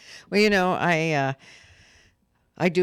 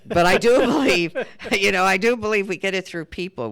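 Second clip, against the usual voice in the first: louder, laughing through the words; 8 semitones higher, 8 dB louder than usual. Real, not acted. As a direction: laugh while insisting. Laughing while asserting belief.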